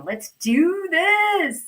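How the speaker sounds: high, long-held rallying cry; playful gung-ho cheer. Pretend. Host planning a lake visit, playful excited cheer. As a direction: stretch it out, rising with excitement.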